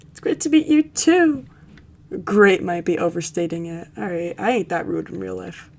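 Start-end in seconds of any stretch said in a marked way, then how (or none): 0.1-1.4 hammed-up cheery. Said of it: "repeats greeting bigger".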